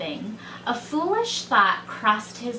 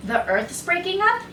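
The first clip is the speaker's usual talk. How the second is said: high, rising disbelieving question; said to himself; 8 semitones higher than usual. A narrator's reading. Second bear to himself after the warning, startled question. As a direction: wonder it aloud, pitched up. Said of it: "he said to himself".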